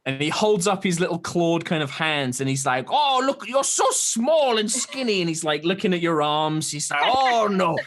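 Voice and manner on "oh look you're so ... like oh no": put-on high, curious old turtle creature voice; a wary hermit peering at strangers; old turtle man. GM voicing the old turtle hermit to the party.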